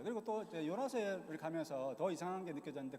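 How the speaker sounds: normal talking voice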